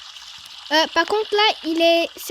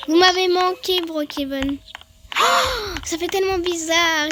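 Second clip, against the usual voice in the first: usual speaking voice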